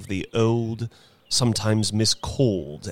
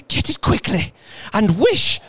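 loud, breathless urgent command; breathless and desperate; frantic; 13 semitones higher, 5 dB louder than usual. Acted. Wife panting frantic command.

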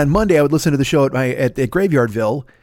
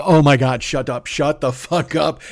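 usual speaking voice

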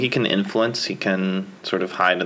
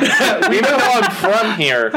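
laughing; 10 semitones higher, slower than usual. Real, not acted. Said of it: "laughing at own bad accent".

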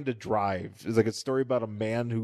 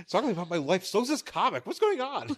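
mock character voice; 7 semitones higher than usual.

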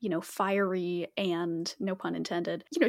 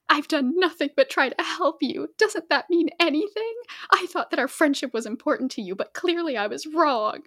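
higher, louder wounded outburst; hurt cat lashing out at Fireheart, raised voice; hurt and angry; 6 semitones higher, 5 dB louder than usual. A narrator's reading. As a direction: throw the hurt back at him. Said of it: "Sandstorm flinched as if he had struck her".

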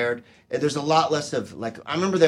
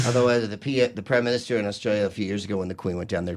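ordinary talk

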